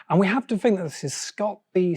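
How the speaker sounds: ordinary delivery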